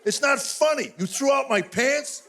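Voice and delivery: high-pitched mock outrage; comic indignation, dragging out the accusation; indignant protest. Retold quarrel over trashed pants.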